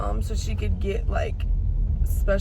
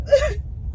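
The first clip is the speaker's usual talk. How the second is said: crying sob; 4 dB louder than usual. Real, not acted. Crying over leaving the cat.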